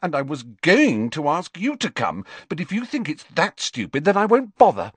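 hurt, huffy outburst climbing in pitch; indignant retort. A narrator's reading. Girl withdrawing her invitation to the boy, indignant. Hurt friend snaps back in quarrel.

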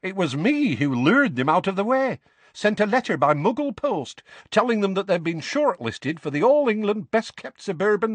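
boastful, pleased with her trick; young witch. Acted. Witch bragging to Harry about the Dursleys, cheeky. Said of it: "said the violet-haired woman".

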